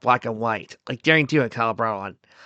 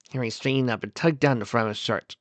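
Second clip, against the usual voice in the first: ordinary delivery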